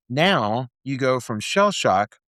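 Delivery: ordinary talk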